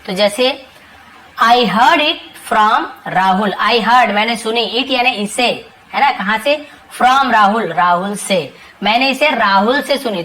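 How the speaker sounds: usual speaking voice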